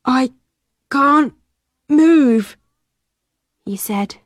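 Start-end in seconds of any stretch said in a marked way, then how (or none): 0.0-2.5 slow, weak. A narrator's reading. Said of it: "very slowly".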